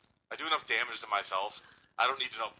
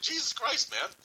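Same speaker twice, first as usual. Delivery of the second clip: shocked exclamation; 12 semitones higher, slower than usual. Retold or imitated. Retold shock at exam.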